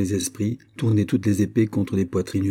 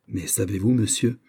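usual speaking voice

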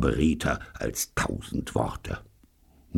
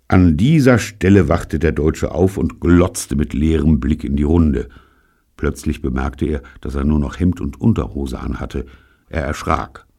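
usual speaking voice

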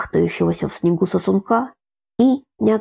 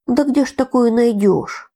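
near tears; 6 semitones higher than usual. A narrator's reading. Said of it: "Танюшка чуть не плакала".

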